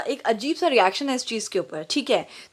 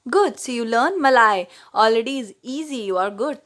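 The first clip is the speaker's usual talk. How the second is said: slow teacherly praise; much slower than usual. Retold or imitated.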